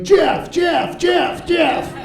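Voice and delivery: very high, shouted alarm call of Jeff; yelling homeless guy. Acted. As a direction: bellow the name like a human alarm. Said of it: "he's like".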